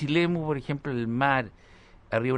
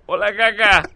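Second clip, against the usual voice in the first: higher, much louder snobby greeting; posh hammed-up hello; 8 semitones higher, 10 dB louder, slower than usual. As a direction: greet the friend by his silly nickname. Saludo pijo fingido. (saludo pijo exagerado, agudo y muy fuerte)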